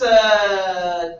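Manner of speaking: ordinary delivery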